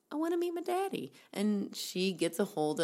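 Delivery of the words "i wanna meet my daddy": put-on high, fast little kid voice; imitating a young child asking. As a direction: pipe it up like a small child. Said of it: "her child is like".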